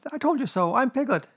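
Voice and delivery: high, squeaky I-told-you-so; triumphant Piglet. A narrator's reading. Piglet proven right to Kanga, high triumph. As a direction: insist gleefully in a small high voice.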